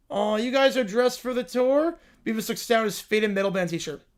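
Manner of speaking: ordinary talk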